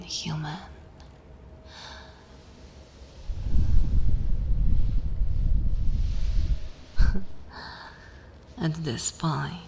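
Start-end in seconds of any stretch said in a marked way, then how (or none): 3.0-7.9 long, voiceless chuckling; breathy laugh. Acted. Vampire amused by human's chills, long breathy laugh.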